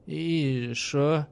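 put-on slurred drunk question. A narrator's reading. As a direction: slur it drunkenly. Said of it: "спросил Станец".

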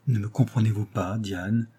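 ordinary delivery, nothing marked

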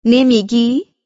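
wife threatens husband outside the house, loud and sharp; threatening. A narrator's reading. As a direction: demand it as a threat. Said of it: "با تهدید گفتم".

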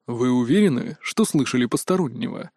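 low, level director's voice; even baritone. A narrator's reading. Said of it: "донёсся до меня ровный баритон".

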